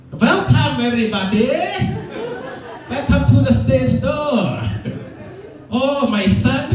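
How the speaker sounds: high, slow mock mom lecture; high-pitched imitation of his mom, slow and preachy; immigrant mom voice. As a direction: ham up a strict Vietnamese mother. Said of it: "could have been up here".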